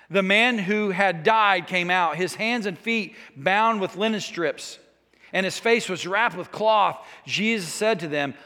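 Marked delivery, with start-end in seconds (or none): none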